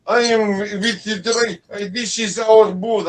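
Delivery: put-on slow, high, loud Russian-accented woman. Wife claiming the booth as hers, heavy Russian accent. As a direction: imitate an imperious Russian woman, drawn out. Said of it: "Where are you from? Russia?".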